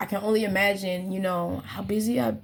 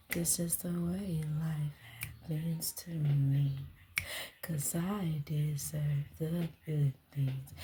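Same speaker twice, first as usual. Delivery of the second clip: breathy, slow whispered affirmation; hushed private whisper; 3 semitones lower, 9 dB quieter, slower than usual. Real, not acted. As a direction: whisper it to yourself, slow and soft. Soft affirmation to herself.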